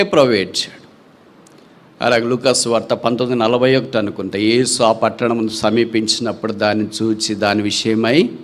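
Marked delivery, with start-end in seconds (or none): none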